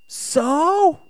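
put-on high, loud kid's "so what"; high, loud childish brush-off; dismissive kid. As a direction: mimic an unimpressed child, pitched high and loud. Son's dismissive reply, acted.

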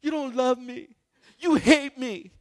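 put-on high, loud whiny child protest; dramatic kid. Pastor imitates dramatic kids accusing parents, high whine. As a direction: pout it like a wronged little kid. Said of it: "These little kids are so dramatic".

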